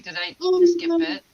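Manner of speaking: high and loud, disbelieving; stunned high outburst; retold shocked disbelief. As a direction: replay the moment you realise you've been bitten. Retold shock at being bitten.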